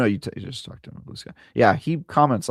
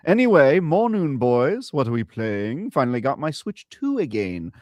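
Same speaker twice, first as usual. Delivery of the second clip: put-on slow, posh British accent, higher; 6 semitones higher than usual. Co-host reading a fan's super chat in a posh British accent. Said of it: "I did British because he paid in pounds".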